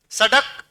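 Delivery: सड़क with a hard retroflex ḍ stop; no flap in सड़क, a plain ḍ. Shown as a demonstration. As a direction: say सड़क as "sa-ḍak", tongue stopping firmly. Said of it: "उच्चारण में फर्क आया".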